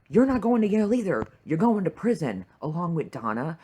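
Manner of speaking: loud, high, fast ranting; angry fan yelling at a character; furious outburst. Real, not acted. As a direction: blast it at him. Ranting at Brett.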